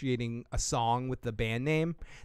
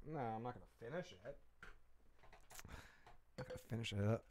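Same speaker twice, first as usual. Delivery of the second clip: softer and softer, talking himself out of it; a drowsy mock mumble to himself; trailing into a murmur; 4 semitones lower, 21 dB quieter than usual. Streamer mumbling to himself about the last beer, much softer. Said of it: "I'm like".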